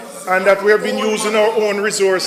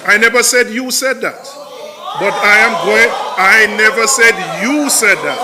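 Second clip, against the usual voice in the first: raised, insistent voice over interruptions; heated rebuttal; 3 semitones higher than usual. Real, not acted. Member firing back at opponents who interrupted him, heated. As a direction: push back hard at the hecklers.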